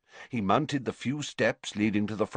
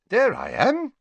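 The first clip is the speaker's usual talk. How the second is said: high, louder, lilting announcement; bright; 13 semitones higher, 5 dB louder than usual. A narrator's reading. Headmaster showing Harry his younger self, brightly. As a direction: point it out brightly, pitch leaping up. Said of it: "said Dumbledore brightly".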